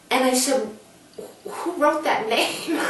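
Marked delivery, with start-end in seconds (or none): 1.5-2.8 high, stunned question, a mild hint of tears; shocked and tearful. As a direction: ask it as if the name just floored you. Retold shock and tears at bakery.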